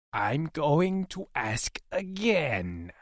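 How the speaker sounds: menacing alien, stretched vowels; threatening alien interrogator. A narrator's reading. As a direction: draw out the threat word by word.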